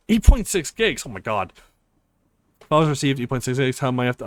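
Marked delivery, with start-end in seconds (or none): 0.1-1.1 shocked. Real, not acted. Shocked by download size.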